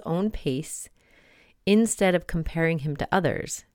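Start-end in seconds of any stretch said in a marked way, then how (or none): none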